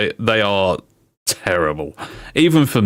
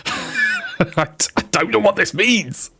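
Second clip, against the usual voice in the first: high-pitched, squeaky talk through laughter; laughing hard; 11 semitones higher, slower than usual. Real, not acted. Streamer baffled by roadman slang, speaking through laughter. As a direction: squeeze the words out while cracking up.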